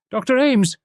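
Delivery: high calling out across the camp; urgent cry. A narrator's reading. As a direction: call the doctor in alarm. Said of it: "I cried".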